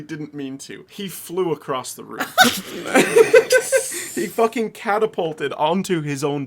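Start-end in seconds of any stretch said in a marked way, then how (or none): 2.3-4.1 high, loud burst of laughter. Real, not acted. Laughing at friend flying.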